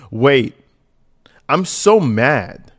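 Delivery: loud, slow angry read; loudly hammed-up anger from her text; mock mad. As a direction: drag out how mad she is, loudly. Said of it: "She gets mad".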